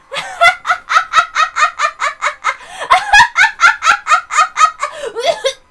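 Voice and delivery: long high, loud gloating cackle rising at the end. Acted. Drifter girl on the beach, loud high cackle. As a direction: cackle like a witch who just washed ashore. Witch arrives laughing from the sea.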